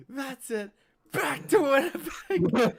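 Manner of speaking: very high, loud, slow with laughter; laughing meme quote. Retold or imitated. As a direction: squeal it through a laugh. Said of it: "I showed that to Darcel".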